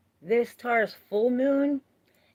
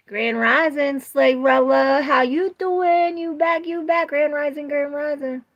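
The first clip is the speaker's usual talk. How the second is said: hype greeting; 7 semitones higher, 6 dB louder than usual. Real, not acted. Welcoming a returning viewer, loud and high. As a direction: greet her like a long-lost friend.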